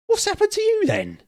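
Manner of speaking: shocked question, voice shooting very high; alarmed. Acted. Shocked at injured arrival.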